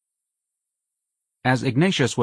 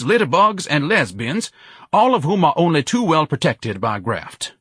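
high, indignant roll-call of vices; pompous bellowing denunciation; bellowed tirade; 9 semitones higher, 4 dB louder than usual. A narrator's reading. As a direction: proclaim each item to the whole crowd in outrage. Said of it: "Ignatius bellowed over the crowd".

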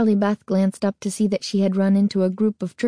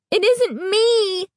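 high slow crying; sobbing denial; 12 semitones higher, slower than usual. A narrator's reading. Girl sobbing at her reflection, high slow crying. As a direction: cry out the words through tears. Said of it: "Carly Beth declared".

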